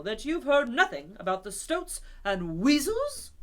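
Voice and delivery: loud, emphatic demand; shout. Acted. Rat shouting and thumping the table at Toad. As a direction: bang it out. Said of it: "shouted the rat".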